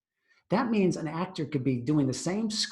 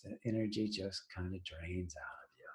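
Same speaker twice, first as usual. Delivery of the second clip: a deflated whisper, energy gone; drained whisper; 5 semitones lower, 14 dB quieter than usual. Shown as a demonstration. Presenter showing how slouching drains energy, limp whisper. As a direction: let the life leak out of the voice. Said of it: "when you're sitting back like this".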